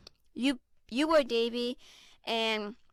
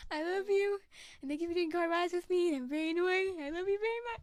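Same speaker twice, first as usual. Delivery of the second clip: teary, higher voice, fighting back tears; crying; 7 semitones higher, 4 dB quieter than usual. Real, not acted. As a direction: speak through real tears to a friend. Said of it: "[name], don't cry on live te".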